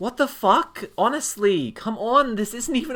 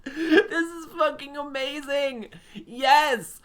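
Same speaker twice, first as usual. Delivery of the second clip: delighted; 6 semitones higher, much slower than usual. Real, not acted. Delighted, laughing.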